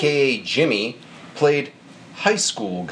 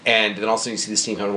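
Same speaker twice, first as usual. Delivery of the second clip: ordinary talk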